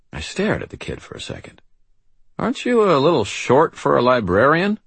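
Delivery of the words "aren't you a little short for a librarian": higher, louder wisecrack; incredulous question. A narrator's reading. Wizard doubts a small child is the archive, incredulous. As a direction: pitch it up in disbelief.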